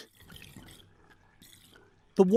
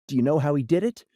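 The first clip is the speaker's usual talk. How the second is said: usual speaking voice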